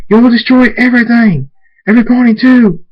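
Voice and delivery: high, very loud shouting; screamed warning. A narrator's reading. Draconet warns Thunderstorm of destruction, screaming. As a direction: yell a frantic warning over the storm. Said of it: "Draconet screams".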